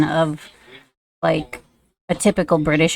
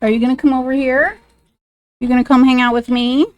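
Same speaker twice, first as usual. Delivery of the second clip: higher, sing-song coaxing to a pet; pet talk; 5 semitones higher, faster than usual. Real, not acted. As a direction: coax the pet over sweetly with rising questions. Talking sweetly to her pet.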